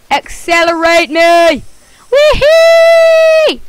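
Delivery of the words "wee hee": high, loud gleeful whoop; gleeful launch whoop. Real, not acted. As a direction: let it fly with joy. Joy on launch.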